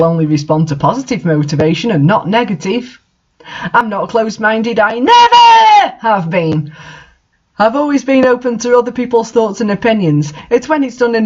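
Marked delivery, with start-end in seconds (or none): 4.8-6.7 "never" drawn out slow, higher and louder; stress on never. Real, not acted. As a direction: lean hard on "never", slowly. Author insisting she is open-minded.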